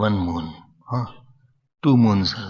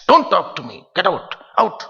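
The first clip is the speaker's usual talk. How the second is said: angry dismissal; 5 semitones higher, 5 dB louder than usual. Retold or imitated. Guru throws disciple out.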